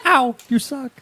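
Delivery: high whiny mock complaint; mock whine. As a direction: whine it like a hurt child. Repeating the jab mockingly.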